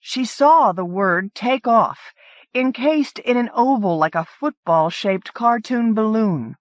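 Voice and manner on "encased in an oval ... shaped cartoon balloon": ordinary delivery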